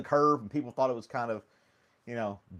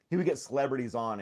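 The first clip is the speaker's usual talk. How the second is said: ordinary talk, nothing marked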